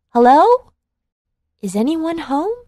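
put-on high, loud little girl's call; Goldilocks calling out. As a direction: call through the door like a curious little girl. Goldilocks calls at cabin door.

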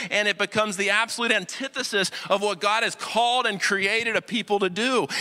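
ordinary talk, nothing marked

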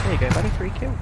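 loud, high taunting call; mock taunt. Real, not acted. Streamer taunting the player he just killed, loudly. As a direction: gloat over the free kill. Mocking dead opponent loudly.

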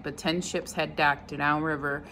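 plain reading voice

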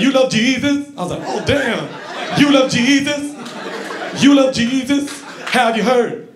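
high insistent preacher; preacher pestering. Retold or imitated. Preacher repeating at him.

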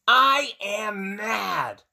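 loud, slow acted declaration of anger; storybook shout of frustration; mad, out loud. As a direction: stretch each word and shout it. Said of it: "Piggy just says right out loud".

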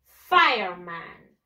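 "Fireman" drawn out slowly as FIRE-man; model English pronunciation. Shown as a demonstration. Teacher modelling for pupils to repeat, "Fireman" as slow English FIRE-man. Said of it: "One more time".

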